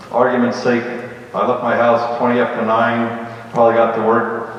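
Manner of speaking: ordinary talk, nothing marked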